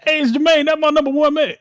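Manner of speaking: high, loud grandpa impression, full of stammered uhs; yelling papa. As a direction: imitate Papa hollering for Granny. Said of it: "do my papa just yelling".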